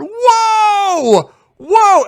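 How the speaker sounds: high, loud mock cheer; big high-pitched "whoa" shout; crew freaking out. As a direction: imitate a whole crew whooping in amazement. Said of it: "everybody freaked out".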